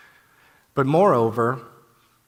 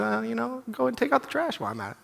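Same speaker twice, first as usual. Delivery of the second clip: high, fast, pleased with himself; chirpy little plan muttered to himself, high and quick; eager self-talk; 12 semitones higher, faster than usual. Retold or imitated. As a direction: rattle it off like a kid on a roll. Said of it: "I said".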